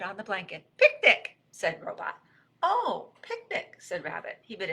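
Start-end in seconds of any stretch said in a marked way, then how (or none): 0.8-1.4 high, loud exclamation of picnic; excited announcement. Acted. Robot announces a surprise picnic. 2.6-3.8 pleased realization. Acted. Rabbit catches on to the picnic.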